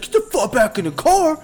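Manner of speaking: high barked order; cop yelling. Retold or imitated. Retelling the cop ordering Brian back into the car, high bark. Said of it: "He's like".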